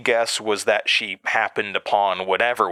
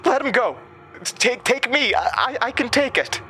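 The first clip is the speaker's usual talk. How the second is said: shouting. A narrator's reading. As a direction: shout to save him. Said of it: "Sam shouted".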